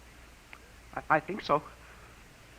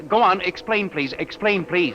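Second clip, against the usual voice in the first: loud, higher, insistent plea; eager listener pressing the caller loudly; urgent pleading; 7 semitones higher, 5 dB louder than usual. Acted. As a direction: beg him to keep explaining.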